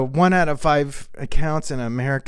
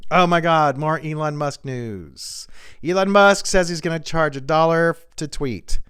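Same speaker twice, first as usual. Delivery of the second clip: ordinary talk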